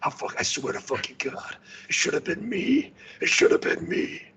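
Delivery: raspy whispered outrage; Leman Russ voice. Retold or imitated. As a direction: growl it out in a hoarse whisper. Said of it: "Lehman Russ is like".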